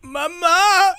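high, drawn-out wailing for mother; mock tearful breakdown. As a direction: wail like a grown son collapsing. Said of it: "he breaks down in tears".

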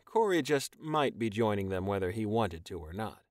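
ordinary delivery

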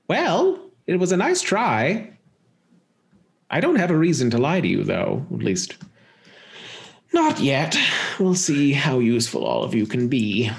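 theatrical villainess, gleefully condescending; amused villainess. Acted. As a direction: toy with them like a cackling villain. Game master plays cackling villain.

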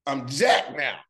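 hammed-up pumped-up guy showing off; Austin. Speaker jokes about Austin after push-ups, playful impersonation. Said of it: "Austin gonna come around and be like".

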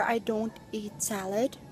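usual speaking voice

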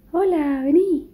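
high sing-song call to a dog; pet voice. Real, not acted. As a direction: coax the dog over, high and bright. Calling the dog over.

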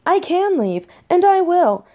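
high-pitched, insistent defiance; defiant. Acted. Daughter threatening to leave her parents, defiant.

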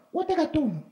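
put-on high small child voice answering his father; a little boy answering, pitched high; child's voice. A boy answering his father in a high child voice.

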